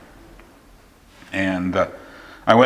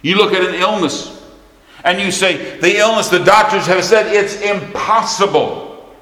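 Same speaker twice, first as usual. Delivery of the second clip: high, forceful pulpit delivery; emphatic preaching; 9 semitones higher, 5 dB louder than usual. Acted. Preacher telling congregation about a hopeless life, high and emphatic. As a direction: build the case hard, then stretch the verdict.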